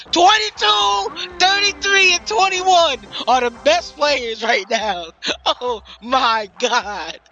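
very high, loud, stretched yelling; hype scream. Real, not acted. Commentator screaming the best players' numbers. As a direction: scream the praise at full volume.

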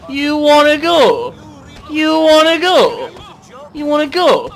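high, loud, drawn-out taunt; belted playful challenge; mock fight challenge. Challenging game characters to fight.

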